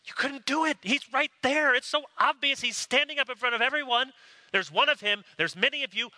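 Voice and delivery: high, exasperated scolding; indignant priests. Retold or imitated. Priests scolding officers for failing, exasperated. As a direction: berate the officers who failed.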